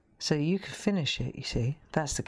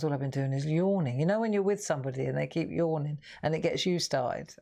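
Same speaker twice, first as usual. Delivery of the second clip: ordinary talk, nothing marked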